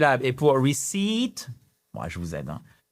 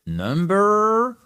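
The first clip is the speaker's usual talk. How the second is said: playful announcement; 7 semitones higher, 4 dB louder than usual. Acted. Teasing pause before number three.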